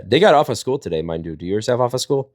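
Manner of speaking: ordinary talk, nothing marked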